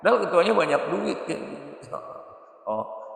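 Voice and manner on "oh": ordinary delivery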